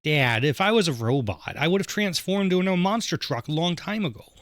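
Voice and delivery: upper Midwest accent. Shown as a demonstration. Daughter tells dad about being a robot, upper Midwest accent. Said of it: "kind of upper Midwest accent".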